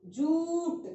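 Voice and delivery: जूट with the u drawn out long. Shown as a demonstration. Teacher showing kids the long u, stretched. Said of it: "खींचना है ज को उ की मात्रा के साथ".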